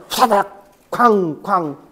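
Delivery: slow, heavy 후다닥 쾅쾅 crash; crashing sound effect. Retold or imitated. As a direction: act out things tumbling down. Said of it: "무너지고 말아 하는 그런 음성". (무너지는 소리 흉내)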